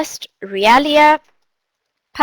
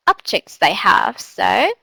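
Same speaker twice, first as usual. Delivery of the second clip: ordinary talk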